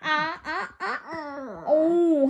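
usual speaking voice

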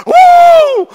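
very high, very loud whooping blow; an even bigger wolf's whoosh; wolf blowing harder. Acted. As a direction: blow with everything the wolf has.

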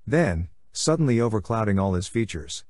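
ordinary delivery, nothing marked

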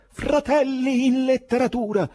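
slow, high, loud rallying cry; shouted address. A narrator's reading. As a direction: shout it out to the whole room. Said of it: "alzò il cero e gridò".

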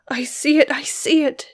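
high, loud cry of horror; shriek. A narrator's reading. Beatrice seeing the dead insects, shrieking. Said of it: "shrieked Beatrice".

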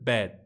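"bed" con la e inglesa, más abierta; bed en inglés. Shown as a demonstration. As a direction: di "bed" como nativo, más grave. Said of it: "si dices bed". ("bed" con vocal inglesa, tono más grave)

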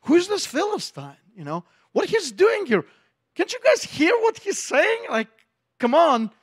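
high, incredulous calls to the soldiers; young David rallying the frightened soldiers; indignant young David. Retold or imitated. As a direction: stir them up, outraged. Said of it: "and he's like".

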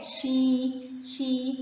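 "شي" stretched as a long shee. Shown as a demonstration. Teacher showing pupils yaa lengthening, "شي" drawn out long. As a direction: hold the ee in "شي" steady. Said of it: "شين كسرة مد بالياء".

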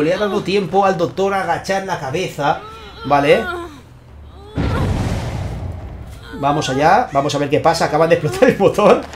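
lively esports caster calling the action; energetic game commentary; live play-by-play. Acted. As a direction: call the match as it unfolds for the viewers. Live casting of tournament match.

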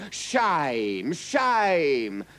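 crowd crying out. A narrator's reading. Crowd protesting the king's cruel order, crying out. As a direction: cry it like a reproachful mob. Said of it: "cried the crowd".

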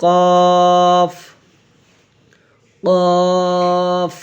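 "কফ" with a heavy back-of-mouth k and rounded o; thick sound. Shown as a demonstration. Teacher showing the thick letter, heavy long "কফ". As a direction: hold "কফ" long, thick and full. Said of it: "মোটা".

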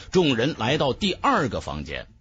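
ordinary delivery

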